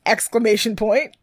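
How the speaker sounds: usual speaking voice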